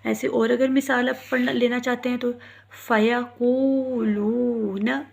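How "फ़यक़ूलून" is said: फ़यक़ूलून chanted slowly, both ū held; recited with long vowels. Shown as a demonstration. Teacher reciting Quran example, slow stretched फ़यक़ूलून. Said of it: "दोनों वाव मद्दा हैं".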